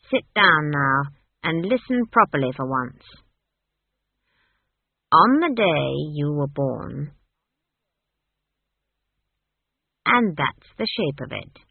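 put-on firm old witch laying down the facts; stern Granny. Granny explains the staff to Esk, stern.

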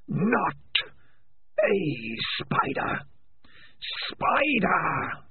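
slow breathless spirit voice; eerie Spider. Acted. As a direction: hiss out the name like a menace.

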